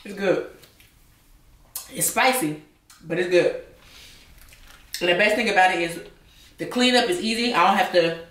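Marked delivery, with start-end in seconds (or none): 0.0-0.5 chewing while talking; mouth full. Real, not acted. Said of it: "talk with my mouth full". 1.9-2.6 talking through food; mouth full. Real, not acted. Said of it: "talk with my mouth full". 3.0-3.6 talking through food; words around a mouthful; mouth full. Real, not acted. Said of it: "talk with my mouth full".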